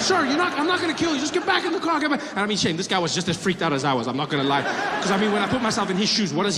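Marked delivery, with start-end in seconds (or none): 0.0-2.2 much higher, jittery cop voice; a spooked officer coaxing him; nervous cop. Retold or imitated. Policeman pleading.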